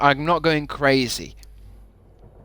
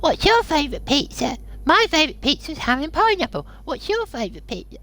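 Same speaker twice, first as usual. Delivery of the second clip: high mocking voice. Mocking chat questions.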